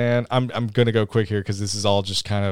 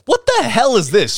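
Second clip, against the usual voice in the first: incredulous; 11 semitones higher, 5 dB louder than usual. Real, not acted. Seeing the eight ball.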